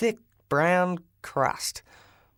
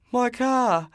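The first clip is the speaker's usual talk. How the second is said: groaned; 7 semitones higher than usual. Acted. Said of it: "groaned the driver".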